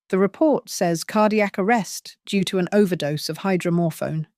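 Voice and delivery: usual speaking voice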